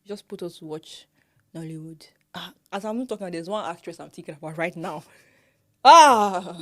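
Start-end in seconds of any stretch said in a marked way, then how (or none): 5.8-6.6 very loud, long falling "ah"; higher cry of mock exasperation; exasperated ah. Real, not acted. Exclaims about an actress's pronunciation.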